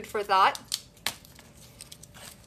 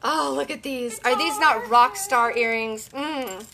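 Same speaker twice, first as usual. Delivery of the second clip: normal talking voice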